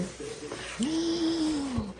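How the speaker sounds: long, high, soft gasp; delighted gasp. Real, not acted. Awed at the unboxed goods.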